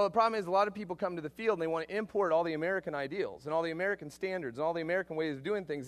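ordinary delivery

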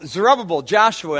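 normal talking voice

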